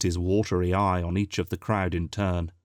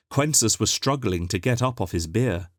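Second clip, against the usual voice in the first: plain reading voice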